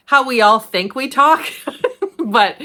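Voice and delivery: laughing through the words, high and loud. Real, not acted. Actor joking about standard American dialect, laughing. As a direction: break into a laugh at your own joke.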